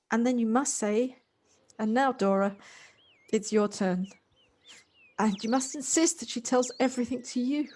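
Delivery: loud, insistent commands pressed home; forceful urging. Acted. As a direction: push him hard to go now.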